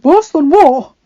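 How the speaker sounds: loud, alarmed, pitch jumping up; anxious question. A narrator's reading. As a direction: demand an answer in rising alarm. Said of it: "Adam asked anxiously".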